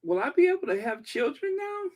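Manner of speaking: high, fast, mock-worried question; hammed-up fretting, high and fast; mock panic. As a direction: fake alarm about her hearing. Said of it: "I'm just like".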